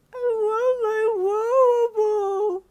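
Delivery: put-on a toddler wailing in panic; panicked scream. A narrator's reading. Toddler screaming for her lost ball, high crying wail. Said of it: "Koemi suddenly screamed in a panic".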